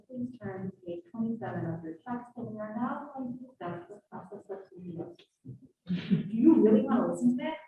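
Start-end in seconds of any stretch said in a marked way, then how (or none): 0.1-6.3 dull lecturer reading out instructions; monotone voice. Shown as a demonstration. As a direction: drone it flat and bored. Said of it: "talking in a monotone voice".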